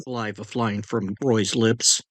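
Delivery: ordinary delivery, nothing marked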